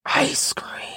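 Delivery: whispered longing for ice cream. Real, not acted. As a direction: breathe it out like a secret. Whispered craving.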